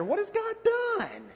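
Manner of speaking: high, slow mocking voice of Satan; slow high sneering taunt; Satan tempting. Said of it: "Satan was saying to Jesus".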